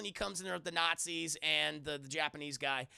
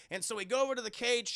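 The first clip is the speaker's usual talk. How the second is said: ordinary talk, nothing marked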